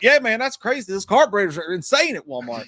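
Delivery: high, loud country bro voice; put-on country talk. Talking to a country guy, he shifts into a high, loud country voice. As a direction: switch into country small talk. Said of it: "you change the way you fucking talk".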